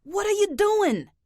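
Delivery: alarmed shout. Acted. Harrison yells at Dorothy nearing the yarn, alarmed shout. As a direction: yell to stop her in alarm. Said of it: "shouted Harrison".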